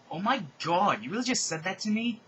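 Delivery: high-pitched, affronted outrage; offended disbelief. Acted. Rodolphe answering Peter's insult, offended disbelief. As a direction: react as if gravely insulted.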